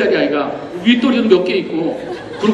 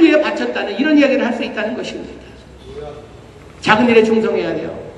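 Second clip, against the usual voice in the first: fervent preaching; 8 semitones higher than usual. Acted. Pastor urging the congregation about God's love, fervent preaching.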